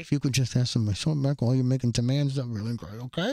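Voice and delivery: a polite king trailing off; imitating King Ezekiel. Ezekiel voiced in retold scene.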